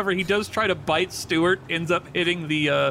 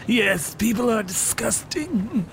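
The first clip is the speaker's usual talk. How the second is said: snake voice; 5 semitones higher, slower than usual. Acted. Snake player voicing Macaroni.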